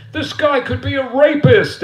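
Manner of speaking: high, loud mock scream; hammed-up outraged shriek; screaming in alarm. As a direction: imitate panicked school staff yelling. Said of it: "They're screaming".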